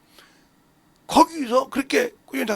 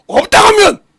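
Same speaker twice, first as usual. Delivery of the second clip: high, loud pulpit exclamation; sudden fervent outcry; emphatic preaching cry; 10 semitones higher, 10 dB louder, slower than usual. Real, not acted. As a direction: hit it hard and high to shake them. Preacher's emphatic rhetorical climax.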